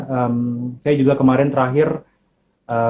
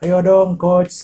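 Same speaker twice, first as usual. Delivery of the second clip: coaxing call; 6 semitones higher, much slower than usual. Retold or imitated. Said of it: "manggil nih ikut".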